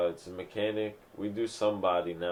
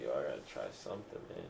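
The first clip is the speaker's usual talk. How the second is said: musing; 9 semitones lower, 13 dB quieter, faster than usual. Retold or imitated. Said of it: "I was like".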